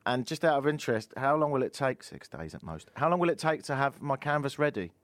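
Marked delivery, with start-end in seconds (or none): 2.0-2.9 much softer and lower aside; feed the answer under your breath; prompting undertone. Real, not acted. Host feeding colleague the plug's answer, quiet low prompt.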